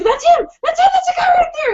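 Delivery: high, loud panicked cries; frantic retold squeal of recognition; imitated freak-out. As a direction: act out a friend shrieking in alarm. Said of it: "And she's freaking out".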